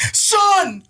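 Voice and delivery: high, loud wail; mock horrified dad. Dad catching his son about to pee, yelling. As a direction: cry out like an appalled father.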